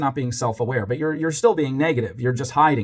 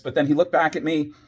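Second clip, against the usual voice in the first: ordinary delivery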